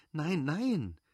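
an eager, high cry to halt him; excited protest. Acted. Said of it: "die aufgeregt seinen Fingern gefolgt war".

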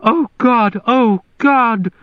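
high shrieking mother's cry. A narrator's reading. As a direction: cry out harshly in shock. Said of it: "cried out in a harsh, shrieking voice".

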